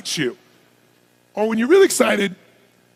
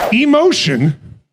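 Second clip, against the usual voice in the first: ordinary talk, nothing marked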